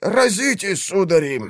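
strained trembling half-whisper; shaking with effort. Acted. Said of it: "прошептал дрожащим от напряжения голосом".

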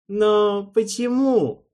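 high, loud, slow cry; anguished plea. A narrator's reading. Alvin begs Erald to explain, anguished. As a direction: drag the why out desperately.

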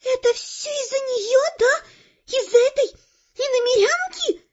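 put-on high, venomous girl's voice; angry hiss. A narrator's reading. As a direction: hiss it jealously, spit the last word. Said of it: "донеслось шипение". (злобно шипя, высоким голосом)

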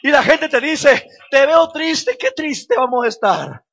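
loud, high, triumphant preaching; full-force preaching. Acted. Pastor defying the devil before the congregation, shouted preaching. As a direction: shout the victory over the devil.

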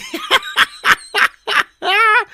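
high, loud cackling laugh; raucous laughter. Acted. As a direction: howl with mocking laughter. Mocking laughter. (harsány röhögés)

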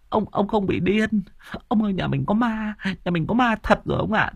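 wife's voice pitched high, panicked; frightened wife. Acted. Wife tells husband the house is haunted, high and panicked. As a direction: blurt it out to the old man, scared.

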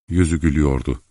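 ordinary delivery, nothing marked

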